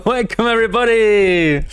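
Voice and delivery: high, hyped arena announcer welcome; wrestling announcer. Acted. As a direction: hype the crowd like a ring MC. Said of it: "Spoken like a wrestling announcer".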